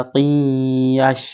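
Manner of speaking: "কিয়াশ" slow, with a long nasal hum before the y; ghunnah. Shown as a demonstration. Teacher shows where to hum, slow nasal "কিয়াশ". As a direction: hold the nasal glide in "কিয়াশ". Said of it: "এখানে গুণনা করতে হবে".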